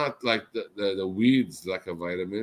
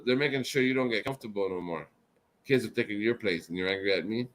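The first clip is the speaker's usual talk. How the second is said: ordinary talk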